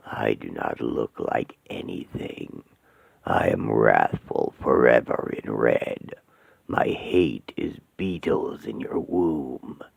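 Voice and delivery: very low, slow, hollow demonic voice. Acted. Demon Asmodeus greeting the trapped women, low and menacing. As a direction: loom over them like a demon.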